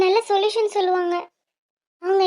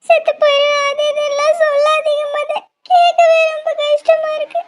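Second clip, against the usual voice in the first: crying plea; 9 semitones higher, 4 dB louder than usual. Acted. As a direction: cry as you beg him to stop. Lover threatens suicide. (அழுகையுடன் கெஞ்சல்)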